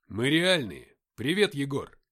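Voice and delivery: loud, emphatic exclamation; exclaiming. A narrator's reading. Shukhov assuring friends he is real and greeting one, exclaiming. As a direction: call it out loudly to convince and greet. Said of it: "воскликнул Шухов".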